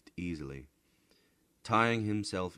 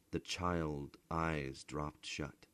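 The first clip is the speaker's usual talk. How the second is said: ordinary delivery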